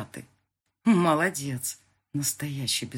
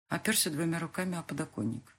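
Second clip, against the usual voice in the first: ordinary delivery, nothing marked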